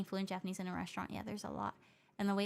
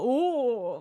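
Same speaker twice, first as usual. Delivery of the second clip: imitating a loud, startled "oh"; mock the people caught off guard; loud, high surprised gasp of a word; 3 semitones higher, 9 dB louder than usual. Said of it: "Most people go".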